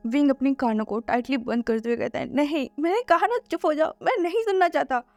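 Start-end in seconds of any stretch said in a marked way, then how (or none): 2.3-5.0 higher, frantic begging to stop; desperate pleading. Acted. Wing refusing to hear the illusion, frantic higher pleas. Covering ears, refusing to listen.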